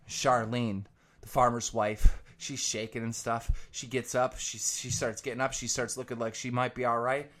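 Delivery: ordinary delivery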